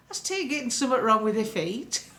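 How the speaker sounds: uncle's broad Yorkshire, amused. Retold or imitated. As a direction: do your uncle in thick Yorkshire dialect. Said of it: "I want to do Rick Broad Yorkshire".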